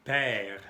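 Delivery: teacher presenting "Père" in French; French pronunciation. Shown as a demonstration. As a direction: model "Père" with an open vowel. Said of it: "se puede escribir así".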